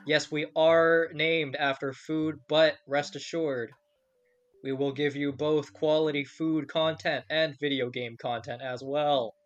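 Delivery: upbeat show-opening announcer read; podcast host intro. Acted. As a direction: welcome the listeners like a radio host.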